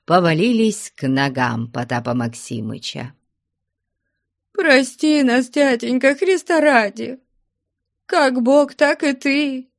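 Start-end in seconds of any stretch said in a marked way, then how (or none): 4.5-9.7 high, keening daughter's voice; wailing plea. A narrator's reading. Daughter at her father's feet begging forgiveness, wailing. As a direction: beg in a high-pitched wail. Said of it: "заголосила, наконец, Параша".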